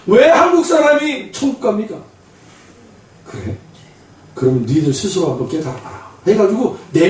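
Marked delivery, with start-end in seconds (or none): 0.1-1.9 high-pitched mock outcry; clamoring protest. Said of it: "아우성거리는 거예요". 3.2-6.1 low, softer acted voice of God; God's calm reply. God answering the protesters.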